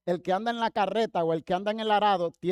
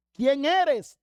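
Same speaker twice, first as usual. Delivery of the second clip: raised, higher-pitched startled question; startled demand; 6 semitones higher, 5 dB louder than usual. Retold or imitated. Blinded Saulo to the unseen voice, startled loud demand. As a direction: call it out, demanding to know.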